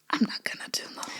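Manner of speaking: a soft private whisper to herself; hushed, as if nobody should hear; whispered inner thought. Retold or imitated. S0 voicing her own unspoken thought, whispered.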